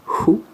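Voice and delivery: who with a breathy English H, said high and loud; English H sound. Shown as a demonstration. Teacher showing the English H sound, who. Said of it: "un H, anglais".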